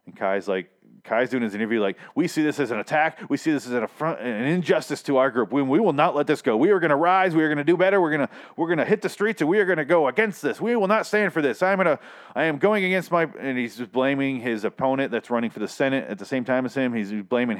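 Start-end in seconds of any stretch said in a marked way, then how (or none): 2.1-13.2 worked-up rally speech, higher pitched and emphatic; ringing vow to fight back, imitated; impassioned speech. Reviewer voicing a candidate's defiant TV interview, impassioned.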